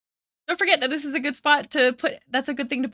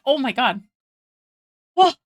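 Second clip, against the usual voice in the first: usual speaking voice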